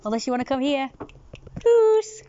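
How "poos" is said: high loud call to a cat. Real, not acted. Owner calls the cat loudly and high. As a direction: sing out the cat's name up high.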